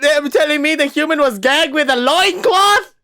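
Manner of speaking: very high, loud incredulous questions; scandalized shrieking. Acted. Reader learns the human was gagged with loincloth, scandalized shriek. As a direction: screech it in outraged disbelief.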